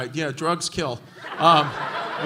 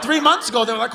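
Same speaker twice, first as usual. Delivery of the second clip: ordinary talk, nothing marked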